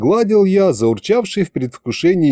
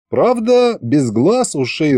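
ordinary delivery

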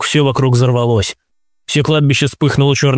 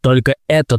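ordinary delivery, nothing marked

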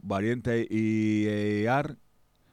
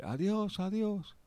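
high voiced farewell; 10 semitones higher, 6 dB quieter, slower than usual.

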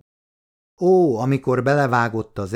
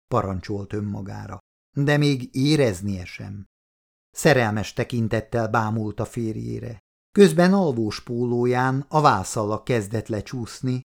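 plain reading voice

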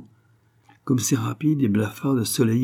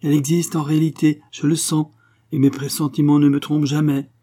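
high, fervent conviction; impetuous insistence; 6 semitones higher, 4 dB louder than usual. A narrator's reading. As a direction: insist hotly, voice pitched up. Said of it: "reprit Angèle impétueusement". (avec impétuosité, voix haute)

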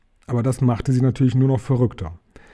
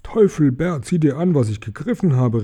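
plain reading voice

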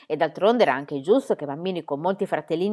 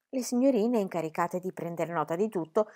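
usual speaking voice

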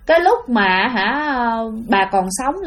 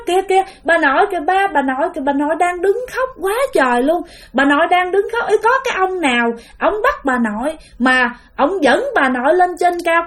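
put-on higher, fast, breathless toddler voice; a small child blurting what she sees; naive little child; 5 semitones higher than usual. Little girl telling her father about grandma, childlike and higher. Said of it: "mà nó nói rất là ngô nghê các bạn".

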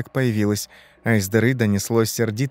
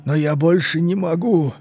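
anguished dying man; 5 semitones higher than usual. Acted. Armchair man can't bear the TV, despairing. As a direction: build from protest into a long wail. Rotting man torn, pleading.

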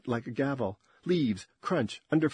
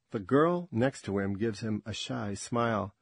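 ordinary delivery

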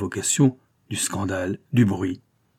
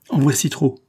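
short, scornful dismissal; curt and disdainful; 6 semitones higher, 4 dB louder than usual. Acted. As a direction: cut him off with contempt. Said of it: "fit-il d'un ton bref et dédaigneux".

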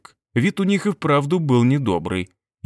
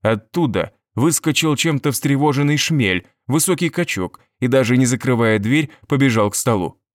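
ordinary delivery, nothing marked

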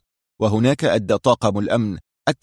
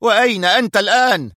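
high-pitched, demanding question; irate, raised-voice boss; sharp; 11 semitones higher, 4 dB louder than usual. A narrator's reading. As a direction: press him angrily for an answer. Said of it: "سأله المدير في حدة".

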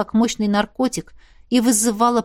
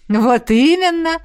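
laughing; 6 semitones higher, 6 dB louder, slower than usual. A narrator's reading. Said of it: "рассмеялся Лин".

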